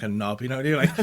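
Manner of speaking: ordinary talk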